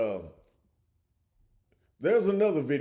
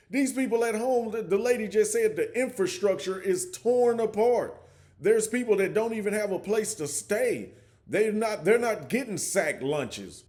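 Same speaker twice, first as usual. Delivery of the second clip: higher, pressing the point hard; indignant, pitched up to make it land; worked up; 7 semitones higher than usual. Real, not acted. Ranting to viewers about suffering islanders, worked up and higher.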